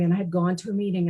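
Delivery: ordinary delivery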